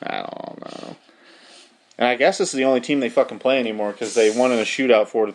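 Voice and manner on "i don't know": very low, soft croak; barely voiced, low and unsure; doubtful. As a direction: let it sink to a quiet low creak. Trails off after doubtful accent joke.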